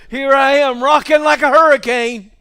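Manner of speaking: mock-grand voice of God showing off. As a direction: boom it like a proud God. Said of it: "I almost won't go".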